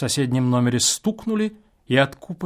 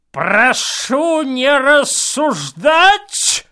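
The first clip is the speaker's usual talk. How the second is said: put-on slow, loud, higher old man's command; 11 semitones higher, 7 dB louder, much slower than usual. A narrator's reading. Old man behind the wall silences the guest, stern. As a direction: order like a cranky old man. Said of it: "старческий голос".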